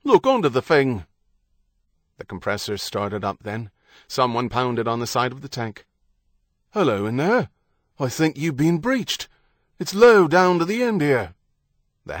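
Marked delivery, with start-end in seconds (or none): 0.0-1.1 loud British docker's order; British dock worker. A narrator's reading. Workers outside inspecting the tank, calling in British accent. 6.7-11.3 raised voice, louder at the end; calling out. A narrator's reading. Dock worker warning of a breach, calling out.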